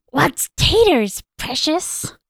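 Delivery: strained, slow Gollum try; forcing out a weak Gollum voice; first Gollum attempt. Urged by friends, a slow, strained Gollum attempt. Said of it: "Do it".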